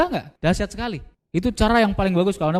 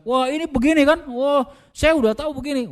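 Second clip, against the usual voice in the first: higher, know-it-all prospect cutting in; bossy choleric prospect; 9 semitones higher than usual. Retold or imitated. Choleric prospect lecturing the salesperson, higher and know-it-all. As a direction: play the pushy prospect who already knows it all. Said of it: "kalau prospeknya koleris".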